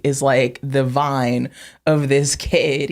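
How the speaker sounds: usual speaking voice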